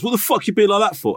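a bloke squaring up, lightly mocked; mock aggro. Bloke outside kebab shop taking offence, mock aggressive. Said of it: "then he goes".

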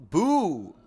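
loud playful boo; jump-scare boo; mock spooky boo.

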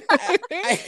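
high laughing burst. Real, not acted. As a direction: crack up mid-sentence. Laughs about going topless.

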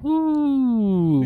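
high jet engine whine, winding down; turbine whine. Retold or imitated. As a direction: mimic a jet engine spinning down. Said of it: "the jet engines were, like, winding down".

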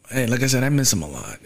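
ordinary delivery

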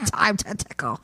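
laughing teller voicing the monster, imagining its taunt, cackling creature voice; high cackle into a gleeful taunt; tickling creature. Teller imagines the monster's taunt, cackling creature voice. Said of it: "he's like, ... I picture him".

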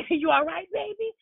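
high, laughing mock comfort; mock consoling mom. Mom checking on her hurt son, high and laughing. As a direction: pretend to soothe the baby, half laughing.

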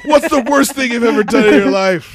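loud, high grilling question; mock interrogator. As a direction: bark it like a cop.

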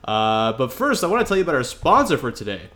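ordinary talk

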